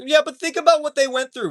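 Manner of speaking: high, mocking put-on voice of a defender; sneering imitation of people excusing Israel; mocking whiny defender. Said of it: "Anybody who's like".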